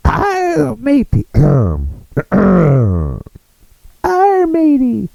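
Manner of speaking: put-on very high squeaky pirate girl. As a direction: shriek it up high like a pirate lass. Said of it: "I don't know if I can do the pirate and the girl voice".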